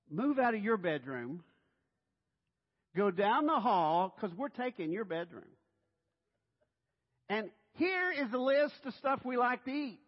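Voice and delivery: loud, high, presumptuous orders; bossy houseguest. Acted. Guest taking over the host's bedroom, bossy ordering. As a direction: lay down the rules as if you own the place.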